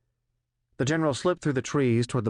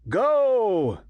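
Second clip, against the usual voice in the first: much higher, much louder roared command; 11 semitones higher, 4 dB louder than usual. A narrator's reading. General ordering the team out the door, roaring. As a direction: bellow it like a launch order. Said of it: "the general roared".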